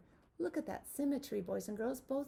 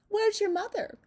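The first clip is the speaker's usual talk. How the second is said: high, loud little lamb; bright high lamb asking; 10 semitones higher, 7 dB louder than usual. Acted. Lamb voiced in a picture book.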